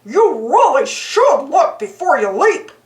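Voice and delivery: dopey cartoon dog voice, mildly scolding; goofy sidekick lecturing a frog. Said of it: "Goofy said to the frog".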